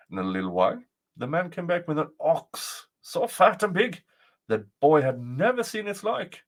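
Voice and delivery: plain reading voice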